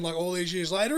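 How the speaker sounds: ordinary talk, nothing marked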